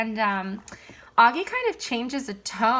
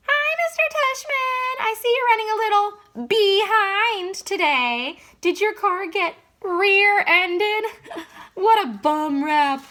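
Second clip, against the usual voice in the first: put-on much higher, slower old lady voice, cracking into laughs; fake old lady voice; 12 semitones higher, 4 dB louder, slower than usual. Dad cracking up his son with a fake old lady voice. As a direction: ham up a wobbly old lady teasing. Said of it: "a fake old lady voice".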